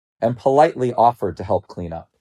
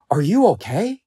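higher, anxious question; concerned lions; 7 semitones higher than usual. A narrator's reading. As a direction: check on him with rising pitch. Other lions worry about him.

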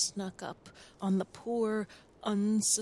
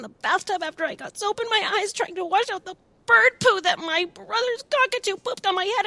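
much higher, faster, louder wail; crying child rant; 11 semitones higher, 5 dB louder, faster than usual. Acted. Sam wails his long list of woes, crying. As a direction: pour out every grievance in one breath.

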